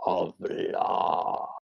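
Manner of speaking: deep, slow otherworldly voice; low, slow voice of an ancient reptile; giant alien booming voice. Acted. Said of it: "what begins as a deep voice".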